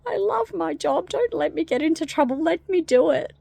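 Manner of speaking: high, loud, whiny begging; pleading door. Acted. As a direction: beg hammed-up for your job. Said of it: "it goes".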